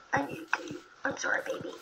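soft unvoiced whisper to the sheep; whispered apology. Real, not acted. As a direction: whisper it like a guilty secret. Apologises to sheep before killing.

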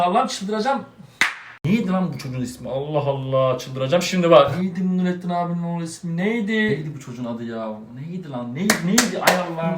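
low, soft grumbling to himself; frustrated muttering. Acted. Father mutters trying to remember a name. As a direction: chew over the lost name quietly.

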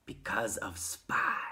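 slow, low, hushed reveal; soft, drawn-out whisper; secretive whisper. A narrator's reading.

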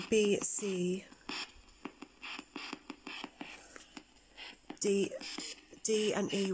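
whispering; leaning in to whisper. Real, not acted. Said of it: "I'm gonna whisper so you can hear".

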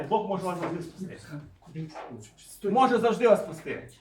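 usual speaking voice

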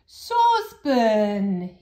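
put-on old friend calling up delighted; yelled name. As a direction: hold the name long and loud. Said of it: "he yelled".

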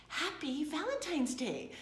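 high, loud, festive call; bright-eyed wake-up cheer; cheery greeting. A narrator's reading. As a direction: sing out the holiday greeting. Cheerily rousing her sleeping husband.

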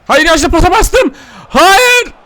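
real anguished cry at a mistake; dismayed shout. Real, not acted. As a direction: shouts in frustration at his own wrong pass. Mistaken pass while gaming.

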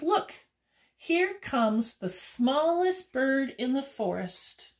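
high goose, slow and wondering. Acted. Goose showing raccoon the smallest bird, high and slow.

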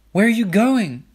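loud, high alarmed cry. A narrator's reading. Char shouting after Ella as she walks away, loud high cry. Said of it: "Char cried".

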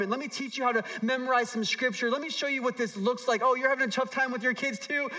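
earnest sermon climax, reaching out to the newcomer; fervent preaching. Acted. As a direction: preach it with rising fervor.

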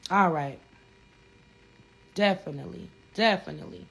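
low, dismissive all rights; low flat sarcasm, letting it sink in; sarcastic low okays. Real, not acted. Sarcastic agreement after the rant.

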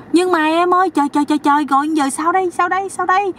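comic panic, voice way up; high mock panic. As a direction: shriek in hammed-up alarm. Sister-in-law shrieks in panic.

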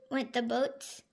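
usual speaking voice

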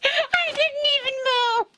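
high protest. Real, not acted. Protesting after mom falls.

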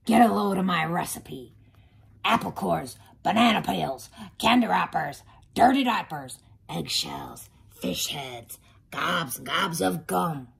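loud, booming truck character; garbage truck voice. Acted. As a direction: boom it like a hungry truck. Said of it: "garbage truck voice".

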